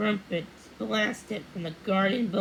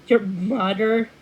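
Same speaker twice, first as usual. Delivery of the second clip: loud, drawn-out astonished question; can't believe what she just heard; surprised exclaim; 9 dB louder, slower than usual. A narrator's reading. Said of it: "Thumbelina exclaimed".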